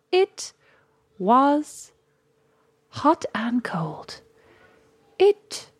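reading verse with a long pause after each line; broken poem lines. Shown as a demonstration. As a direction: isolate IT and WAS as if each were a line of poetry. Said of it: "put them on any line you want".

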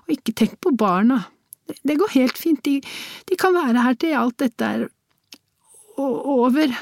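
high, halting woman's voice; sniffling woman. A narrator's reading. Woman telling him not to worry about the children, sniffling. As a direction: reassure him in a high, tearful voice. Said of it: "la hun til".

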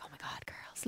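hushed, whispered fangirl gasp; mock fangirl. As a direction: whisper it like an imitated starstruck fan. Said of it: "Like fangirling".

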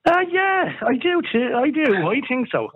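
muffled, like a hand over the mouthpiece; muffled phone. Real, not acted. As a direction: talk through a covered phone. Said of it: "phone is a bit muffled there".

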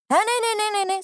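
high, rapid-fire mock protest; flustered denial. Caught stranger hastily denying everything.

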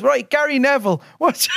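high, loud mock announcer call; retold MC announcement. MC springing the impression on him at the football, loud call. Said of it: "and he says".

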